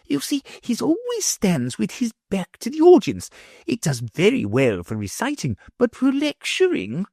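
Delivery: put-on high, careful old scholar; timid professor. A narrator's reading. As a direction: explain apologetically. Professor voiced by reader.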